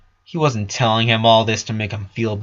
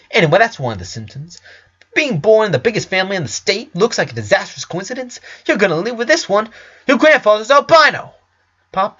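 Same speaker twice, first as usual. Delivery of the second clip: higher, louder, rapid and sardonic; smug ranting doctor; 9 semitones higher, 3 dB louder than usual. Acted. Doctor mocking Lincoln's diagnosis, higher, louder and fast. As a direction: rattle it off like a cocky physician showing off. Doctor explains grandfather's condition.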